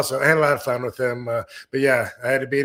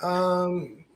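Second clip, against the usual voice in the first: put-on higher, hesitant little kid voice; 6 semitones higher, 4 dB louder, much slower than usual. Imagining how Joe C might have sounded, a lightly higher little kid voice. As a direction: hem and haw like a small child. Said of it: "talk like a little kid and go".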